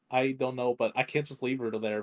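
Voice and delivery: ordinary delivery, nothing marked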